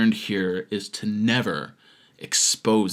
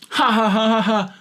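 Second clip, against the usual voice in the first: high, loud "ha ha ha"; exaggerated spelled-out laughter; mock laugh; 9 semitones higher, 4 dB louder than usual. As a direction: read out a fake laugh. Reads out a commenter's written laugh.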